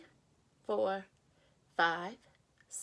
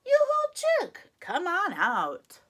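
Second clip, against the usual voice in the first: high, loud call to the chick; cheering shout up high; 9 semitones higher, 6 dB louder than usual. Acted. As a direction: call out to the egg, full voice. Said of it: "everybody shout".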